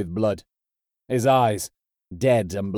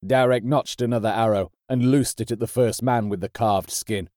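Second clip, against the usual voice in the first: usual speaking voice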